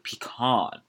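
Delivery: "pecan" drawn out slowly as pee-KAHN; fancy pee-KAHN. Shown as a demonstration. Podcaster savouring the fancy version, "pecan" as slow pee-KAHN. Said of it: "Um, or pecan".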